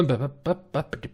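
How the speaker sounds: usual speaking voice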